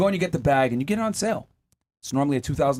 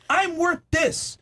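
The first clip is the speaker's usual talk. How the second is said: slow, much higher, prissy self-importance; mimicking women who claim they're worth more, haughty and drawn out; mocking haughty woman; 12 semitones higher, much slower than usual. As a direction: drag it out like a snooty diva. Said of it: "and say".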